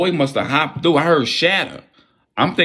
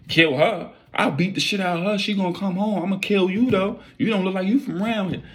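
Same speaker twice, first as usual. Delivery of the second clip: high mock thug voice; 9 semitones higher than usual. Voicing intruder.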